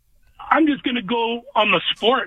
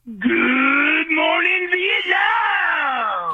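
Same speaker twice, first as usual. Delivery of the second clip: long drawn-out radio shout, higher; Good Morning Vietnam call; 5 semitones higher, much slower than usual. Retold or imitated. Caller imitating Robin Williams' radio DJ greeting, drawn-out shout. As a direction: belt it like the DJ's morning sign-on. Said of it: "Adrian Cronauer when he would say".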